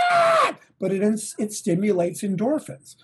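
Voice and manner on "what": high shouted what; mock shocked shout; startled body. Body reacting to push-ups.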